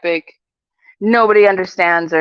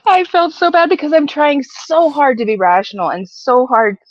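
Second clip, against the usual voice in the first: usual speaking voice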